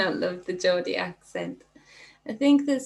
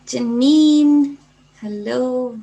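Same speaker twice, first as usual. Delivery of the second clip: excited greeting; 3 semitones higher, 10 dB louder, much slower than usual. Real, not acted. Greeting a viewer joining the stream.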